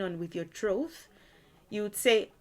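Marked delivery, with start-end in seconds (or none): none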